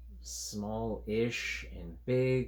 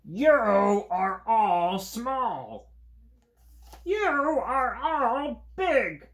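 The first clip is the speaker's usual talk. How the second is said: much higher, louder, very slow creature chorus; creature crowd shouting; 13 semitones higher, 10 dB louder, much slower than usual. Acted. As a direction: call it out across the crowd of creatures.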